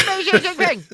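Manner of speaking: hammed-up foreign leader; Xi Jinping impression. Shown as a demonstration. [name] shows a second voice as Xi Jinping, high and loud. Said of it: "then you talk like this".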